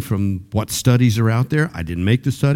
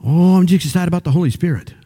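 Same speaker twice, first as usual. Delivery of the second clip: pumped-up answer; 6 semitones higher, faster than usual. Retold or imitated. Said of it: "so pumped".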